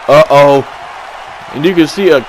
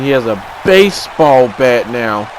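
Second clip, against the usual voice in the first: ordinary delivery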